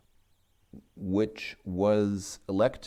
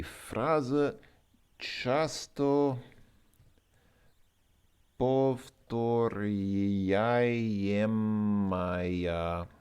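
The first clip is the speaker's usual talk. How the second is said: drawing the word out syllable by syllable; slow sounding out; 3 semitones higher, much slower than usual. Real, not acted. Learner working through a Russian word, very slow. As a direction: stretch повторяемая very slowly, sound by sound.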